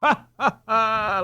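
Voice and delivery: high mocking laugh. A narrator's reading. Peter laughing at the robber's bribe, laughing. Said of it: "laughed Peter".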